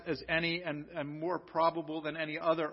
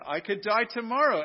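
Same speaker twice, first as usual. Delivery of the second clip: normal talking voice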